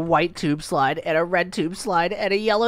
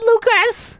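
excited call; 4 dB louder than usual. Real, not acted. Thrilled after ride.

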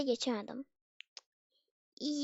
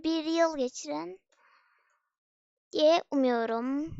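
ordinary talk

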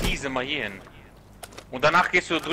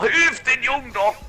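high, slow, louder plea; pleading shout; 11 semitones higher, 5 dB louder, slower than usual. Real, not acted. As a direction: cry out for help for him. Second take much higher.

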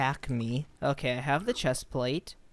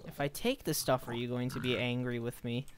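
soft cautious murmur, much quieter; hushed; 3 semitones lower, 7 dB quieter than usual. Real, not acted. Gamer quietly asking piglins about taking loot, hushed. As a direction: ask it softly, tiptoeing.

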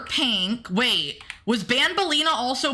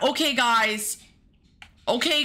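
usual speaking voice

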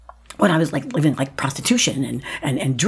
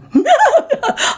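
high, loud involuntary wail; uncontrollable wail; 8 dB louder than usual. Retold or imitated. Actress losing control at a play reading, uncontrollable wail. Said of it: "I can't control myself".

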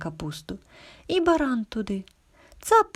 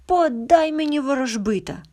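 mock shout, higher and louder; goat's shout; 7 semitones higher, 7 dB louder than usual. A narrator's reading. Brave goat demands the fortune-teller from the wolves, storyteller's raised shout. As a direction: call it out boldly, a narrator's shout. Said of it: "як закричить".

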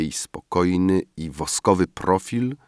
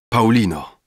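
exclaimed name; 4 semitones higher, 5 dB louder than usual. A narrator's reading. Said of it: "wykrzyknąłem".

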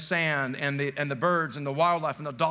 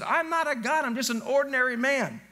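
high-pitched, pleading denial; acted-out insistent disclaimer; emphatic protest; 7 semitones higher than usual. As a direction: protest it in a raised voice. Acts Paul protesting being worshipped.

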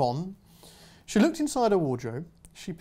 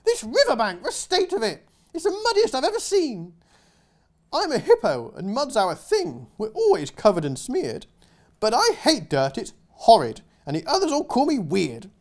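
much higher, louder, prissy hippo voice; fussy hippo; 9 semitones higher, 6 dB louder than usual. Acted. Hippo complaining about mud, prissy and high. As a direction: whine like a dainty hippo.

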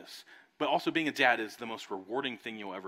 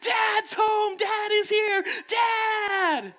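put-on high, loud excited children's shout; kids yelling; 11 dB louder, much slower than usual. Children greeting dad at the door, high excited yell. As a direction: scream it like thrilled little kids. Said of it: "three small voices yell at the top of their lungs".

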